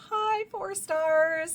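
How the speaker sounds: high, loud, slow and drawn out; thrilled squealing announcement; excited gush. Real, not acted. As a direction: sing out the rating with delight. Gushing over the rating.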